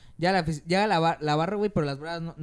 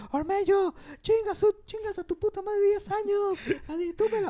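high, yelled insult from the stands; mock fan yell. Fan in the stands heckling a player, high mock yell.

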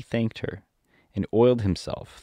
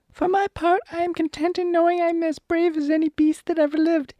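very high cartoon lion voice; squeaky boastful lion, drawing out the last words; self-satisfied lion. Lion declares himself content with his courage, mock-modest high voice. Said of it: "said the lion modestly".

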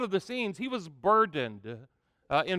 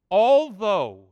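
ALTHOUGH said loud and drawn out; "Although" called out to the congregation; stressed word; 7 dB louder than usual. Shown as a demonstration. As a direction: lean hard on "Although". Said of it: "say that word with me".

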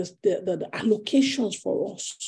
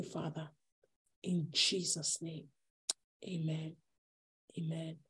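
low, very soft closing prayer; hushed prayer; 3 semitones lower, 6 dB quieter than usual. Real, not acted. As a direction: let the prayer sink to a quiet, reverent murmur. Closes the prayer softly.

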